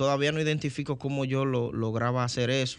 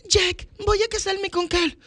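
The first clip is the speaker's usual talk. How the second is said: much higher falsetto Rose. Shown as a demonstration. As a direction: pitch it up into a lady's voice. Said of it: "Titanic scene acted out".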